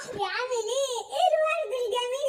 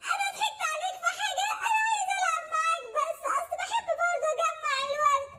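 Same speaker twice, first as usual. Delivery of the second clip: higher, whining mock sobs of a sly fox; hammed-up self-pity to trick the rabbit; fake crying fox; 5 semitones higher than usual. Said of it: "ما تعيطش".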